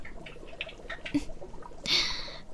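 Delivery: breathy little laugh; quiet unvoiced giggle; soft whispered chuckle. Monster chef chuckles softly.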